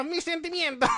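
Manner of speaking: small, half-hearted wounded reply; mock hurt. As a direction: act mildly hurt through laughter. Hurt Pokémon reply, acted lightly.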